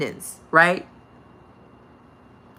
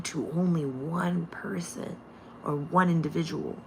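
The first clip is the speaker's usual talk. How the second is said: whisper; 3 semitones lower, 9 dB quieter than usual. Real, not acted. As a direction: drop to a whisper to stress it. Hushed emphasis in teaching.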